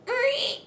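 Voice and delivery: high, rising door creak; creaky door imitation. Mimicking an old Western saloon door, high creaky squeak. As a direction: squeak like a swinging hinge.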